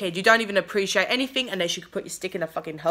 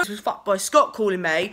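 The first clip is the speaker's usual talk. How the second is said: ordinary talk, nothing marked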